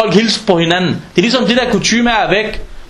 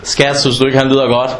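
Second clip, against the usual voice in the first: doting parent; 6 semitones lower than usual. Retold or imitated. Doting parent about own child.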